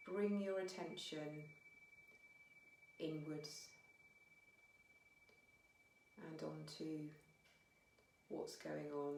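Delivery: unhurried meditation guiding with long pauses; guided meditation. Real, not acted. Teacher guiding listeners to notice their breath, calm meditation voice. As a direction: ease them gently inwards, phrase by phrase, leaving space.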